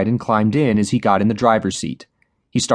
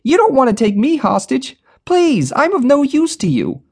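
very high, loud desperate pleading; protested; 3 dB louder than usual. A narrator's reading. Duncan begging the robber not to take him hostage, desperate plea. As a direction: beg not to be taken. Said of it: "Duncan protested".

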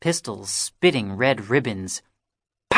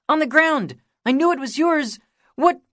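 put-on excited boy showing off a find; eager kid. A narrator's reading. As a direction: pipe up like an eager younger kid. Kiki voiced by narrator.

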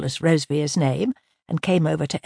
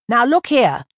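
louder, higher angry protest; 6 semitones higher, 6 dB louder than usual. Acted. Nick protesting to the inspector on Eleanor's behalf, angry. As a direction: cut in hard to defend her. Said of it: "said Nick angrily".

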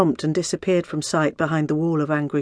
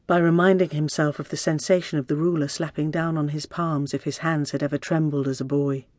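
plain reading voice

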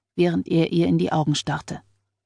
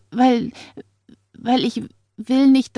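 frightened stammer; 6 semitones higher than usual. A narrator's reading. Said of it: "hämmerte Neles Herz".

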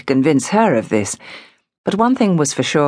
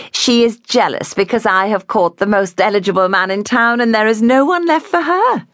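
high-pitched bride's voice, climbing higher to the end; teasing young bride; 7 semitones higher than usual. A narrator's reading. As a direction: play the boastful new wife, voice rising. Voices Elizabeth in dialogue.

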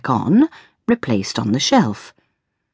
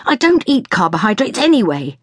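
high-pitched, mildly raised yell; exasperated, pitched-up retort; 8 semitones higher than usual. A narrator's reading. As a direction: call it out in irritation. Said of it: "I yelled".

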